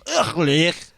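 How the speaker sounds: Leich, said with a throaty ch; a disgusted ugh, then Leich; Leich pronunciation. Shown as a demonstration. Co-host trying the old spelling, grossed out. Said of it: "pronounced like Leich or something".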